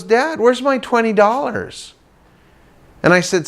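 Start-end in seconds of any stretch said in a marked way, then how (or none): 0.0-1.9 put-on kid voice. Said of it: "He goes".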